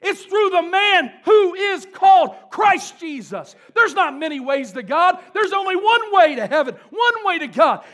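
shouted high preaching, slowed; full-force preaching. Real, not acted. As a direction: thunder it from the pulpit.

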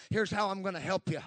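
ordinary talk

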